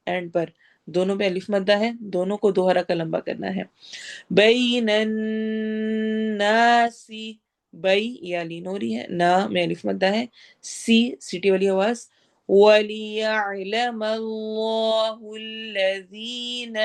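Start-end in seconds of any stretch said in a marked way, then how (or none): 4.3-7.1 slow melodic tajweed chant; measured Quranic recitation. Shown as a demonstration. Recites verse phrase. 12.5-16.8 slow melodic tajweed chant; measured Quranic recitation. Shown as a demonstration. Recites verse phrase.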